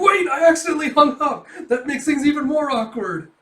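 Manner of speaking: hammed-up fluster; flustered panic. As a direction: panic after hanging up by mistake. Kafka panics after hanging up.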